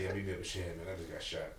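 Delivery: soft, low, unbothered; calm and peaceful. Retold or imitated. Shot friend calling, soft and calm. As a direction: play it ice cold and quiet. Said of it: "He said".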